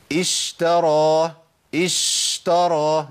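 اشترى with the sh stretched far too long; over-held shin. Shown as a demonstration. Teacher warning students against excess, over-held shin in اشترى. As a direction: hiss the sh of اشترى on and on, overdoing it. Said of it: "ده كتير، اشترى".

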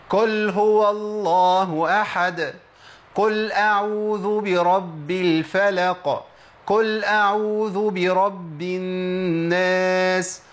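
slow chanted recitation with قل said as kul; qaf turned kaf. Shown as a demonstration. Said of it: "كل دي منين يا شيخ؟". (تلاوة ممدودة بنطق قل كل)

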